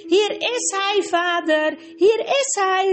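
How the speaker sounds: hoog en uitgelaten, een jongen die zijn vader roept; opgewonden roepen. Acted. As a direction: roep het blij naar je vader. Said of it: "riep Corin". (hoge, opgetogen jongensroep)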